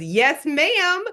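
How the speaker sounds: high, emphatic cheerful answer; happy reply. Retold or imitated. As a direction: imitate a delighted worker answering proudly. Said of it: "Are you always this happy?".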